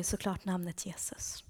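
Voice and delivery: whispered reveal. Real, not acted. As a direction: hush it like a secret. Dramatic reveal of the answer.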